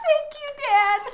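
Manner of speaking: high, squealing thanks with laughter; giddy delight. Real, not acted. Artist thanks Dan for hidden favorite snacks, giddy delight.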